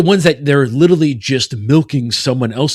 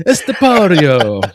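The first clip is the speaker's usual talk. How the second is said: slow, drawn-out Italian flourish, higher; mock Italian accent; 4 semitones higher, slower than usual. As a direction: stretch it out like a hammy Italian. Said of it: "Did you just use Italian?".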